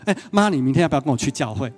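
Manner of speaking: rattled off fast and casual; hurried casual ask to mom; quick offhand invite. Retold or imitated. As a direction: toss it out quickly and move on. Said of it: "我做什么事情吗？".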